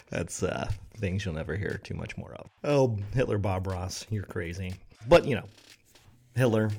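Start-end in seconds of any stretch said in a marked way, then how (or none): none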